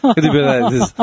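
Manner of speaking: normal talking voice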